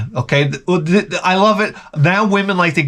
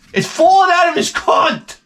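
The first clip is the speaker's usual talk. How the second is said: punchline shout. Real, not acted. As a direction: yell the punchline out. Comic punchline.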